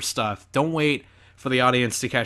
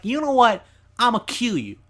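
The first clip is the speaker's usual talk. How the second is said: high-pitched New York threat; New York accent; 8 semitones higher than usual. Acted. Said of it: "I don't know why he slipped into New York".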